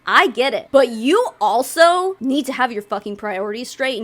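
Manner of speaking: usual speaking voice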